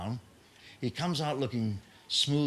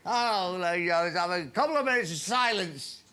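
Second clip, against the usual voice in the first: tearful club secretary; 6 semitones higher than usual. Retold or imitated. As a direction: announce the chairman's death like a grieving club secretary, hammed up. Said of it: "club secretary continues".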